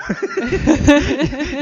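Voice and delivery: a loud, high laugh bursting out; high burst of laughter. Real, not acted. Laughing while recalling the student's objections. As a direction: laugh hard at the memory.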